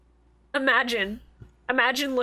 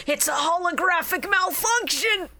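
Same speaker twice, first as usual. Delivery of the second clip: higher-pitched mock exclamation; mock Kaiba outburst; 5 semitones higher than usual. Podcaster imitating Kaiba blaming magic on technology, mock outburst. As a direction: imitate an exasperated denial. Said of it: "Kaiba's default of shit going wrong".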